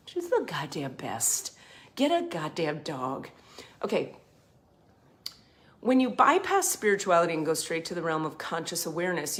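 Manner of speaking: ordinary talk, nothing marked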